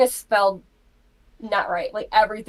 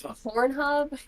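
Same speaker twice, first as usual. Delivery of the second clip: "Pornhub" raised high as a question; retold mom querying "Pornhub"; 6 semitones higher than usual. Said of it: "Question mark".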